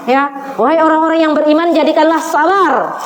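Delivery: ordinary delivery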